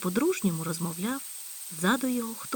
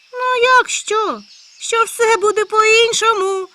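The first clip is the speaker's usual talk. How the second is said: high, loud, slow joyful certainty; joyful and confident; 15 dB louder, much slower than usual. Acted. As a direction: proclaim it gladly, sure of himself. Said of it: "радісно та впевнено заявив заєць". (радісно та впевнено, високо й гучно)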